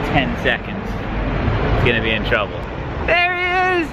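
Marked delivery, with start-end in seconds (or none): 3.1-3.9 much higher, thrilled cry as he finally spots his friend; excited shout. Real, not acted. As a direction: yell it out in delight, high and drawn out.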